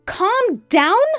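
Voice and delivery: high, loud, rising echo; outraged disbelief. A narrator's reading. Wife echoing her husband's plea, outraged. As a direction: throw the words back as an indignant question. Wife throws his words back.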